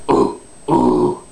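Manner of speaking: two very low, throaty grunts; caveman grunts. Shown as a demonstration. Coach demonstrating an easy route to false chords, caveman grunting. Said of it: "You can talk like a caveman".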